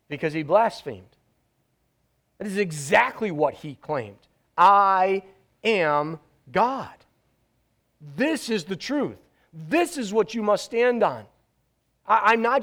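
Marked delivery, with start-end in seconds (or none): 4.6-6.9 very slow, every word weighed; solemn slow claim. Acted. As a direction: lay down each word like a verdict. Voicing Jesus' claim.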